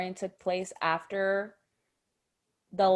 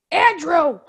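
high, loud cartoon dad yell; Andrew's dad yelling; 8 semitones higher, 13 dB louder than usual. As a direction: yell the son's name like a fed-up dad. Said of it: "Andrew's dad or whatever his name is".

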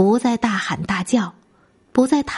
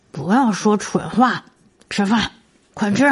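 marbles in mouth. A narrator's reading. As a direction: mouth full of food, mumbled. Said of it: "满嘴塞着饭，口齿不清地说".

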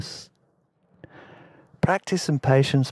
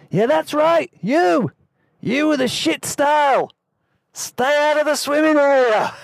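much higher, louder Aussie loudspeaker shout; lifeguard shout; 4 dB louder than usual. Retold or imitated. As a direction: bellow like a lifeguard on a megaphone. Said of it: "The lifeguard's voice relayed through the loudspeakers".

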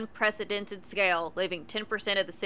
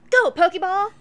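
mock Pokemon shout; 10 semitones higher, 12 dB louder than usual. Playful Pokemon trainer throw.

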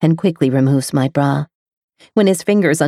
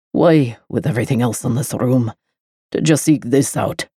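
a hint of a lower male voice; lightly voiced man; 5 semitones lower than usual. A narrator's reading. The lover to the girl, lightly voiced low. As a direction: ask her, a shade lower.

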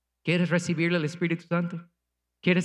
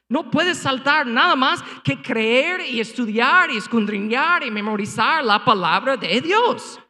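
ordinary talk, nothing marked

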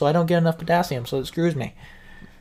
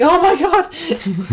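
long drawn-out squeal, much higher; strained high wail; 5 dB louder, much slower than usual. Retold or imitated. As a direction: wail it out slowly in pain. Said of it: "You're like".

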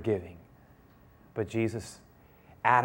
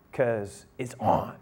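sly punchline; 4 semitones lower, 5 dB quieter, much slower than usual. Acted.

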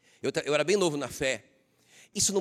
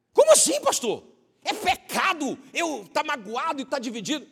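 incredulous churchgoer; 10 semitones higher than usual. Pretend. Imagined church member objects.

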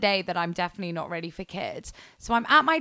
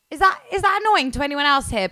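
exasperated question; 6 semitones higher, 5 dB louder, faster than usual. Retold or imitated. Asking office about whistling.

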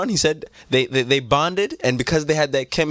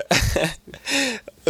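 laugh; 10 semitones higher than usual. Real, not acted.

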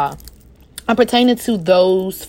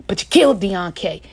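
normal talking voice

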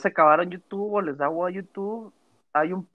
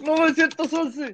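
mock emergency call; 11 semitones higher than usual. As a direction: cry out like calling for help. Mock alarm after coughing.

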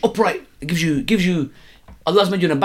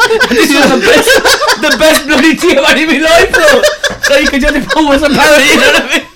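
laughing; 12 semitones higher, 9 dB louder than usual. Real, not acted. Keeps trying the line, laughing.

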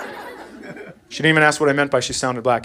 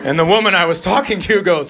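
ordinary delivery

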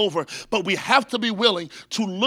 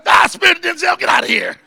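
loud, higher mock rebuke; 7 semitones higher, 8 dB louder, faster than usual. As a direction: cast out the spirit with a playful shout. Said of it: "I would be able to say".

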